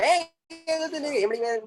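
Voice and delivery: high-pitched hammed-up quarrel; mock squabbling. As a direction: imitate someone starting a petty argument. Mimes squabblers at the shop counter.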